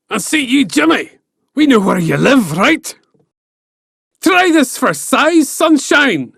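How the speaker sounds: loud, high, Glaswegian-style threat; Scottish giant threat. Acted. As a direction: snarl the challenge like a street fighter. Benandonner roaring threats back.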